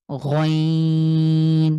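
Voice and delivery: গইন said from the back of the throat, long; long Arabic ghain; throaty ghain. Shown as a demonstration. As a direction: gargle গইন from the throat's end. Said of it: "কণ্ঠের শেষ থেকে আমরা try করব".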